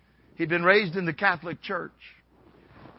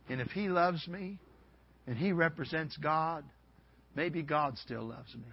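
soft, low, reflective retelling; hushed realization; 3 semitones lower, 11 dB quieter than usual. Retold or imitated. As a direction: think it through quietly, dawning on you. Man's quiet realization, retold.

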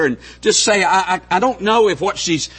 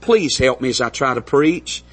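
earnest prayer; 5 semitones lower than usual. Acted. Pastor leads a prayer.

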